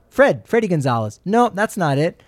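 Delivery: ordinary delivery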